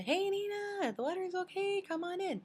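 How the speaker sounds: high, carrying call to a friend up the beach. Retold or imitated. Calling roommate Nina on the shore, high carrying call.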